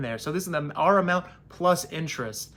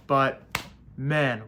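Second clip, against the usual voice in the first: ordinary delivery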